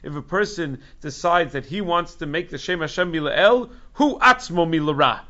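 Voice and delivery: building to a loud, emphatic verdict; sharp rebuke. Retold or imitated. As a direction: quote it as a stinging condemnation, rising in force. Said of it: "Nod Yehuda wrote very sharply".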